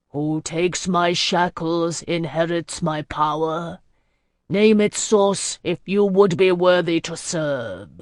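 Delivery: slow, grave voice of an ageless sea lord; ancient sea lord. Acted. Sea lord questioning the gathered mortals, slow and grave. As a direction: let each word roll out like the tide. Master of the Straits speaks.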